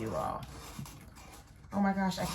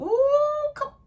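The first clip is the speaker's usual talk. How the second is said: sudden high loud ooh; squeal; 7 dB louder than usual. Real, not acted. Catching herself mid-sentence, a high squeal. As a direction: yelp it out high.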